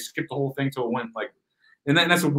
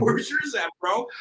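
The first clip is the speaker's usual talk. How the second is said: squeaky high jab, half crying; mocking pitched-up retort to a cop; mock high whiny challenge; 8 semitones higher, slower than usual. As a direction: whine it up high like a mock complaint. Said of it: "I'm like".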